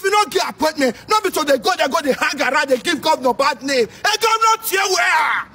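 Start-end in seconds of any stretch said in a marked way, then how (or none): 3.9-5.4 high mocking call of a hanger-on. Mocking the governor's hangers-on, high mock call. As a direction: imitate a hanger-on shouting for the governor, much higher.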